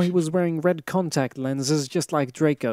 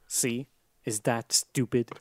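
usual speaking voice

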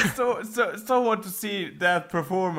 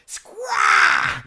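loud shrieking bird cry; bird squawk; 6 dB louder than usual. Pretend. Friend asks for a bird call, squawk loud. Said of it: "I want you to go squaw again".

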